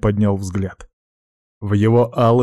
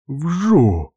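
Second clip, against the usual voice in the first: imitated whoosh of a pipe; 6 semitones higher than usual. A narrator's reading. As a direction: make the swish sound.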